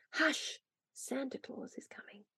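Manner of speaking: very soft, excited announcement in a whisper; hushed whisper. A narrator's reading. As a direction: whisper it to hush a room. Mother hushes the excited room.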